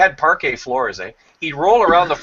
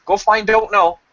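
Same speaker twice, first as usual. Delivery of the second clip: higher, a touch louder command; mother to parrot; 9 semitones higher, 4 dB louder than usual. Retold or imitated. Mother sending the parrot after the baby, lightly raised. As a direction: send the parrot off like a pet. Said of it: "the mother would look at the parrot and say".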